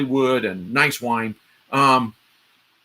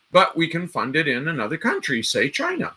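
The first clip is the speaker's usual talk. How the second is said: normal talking voice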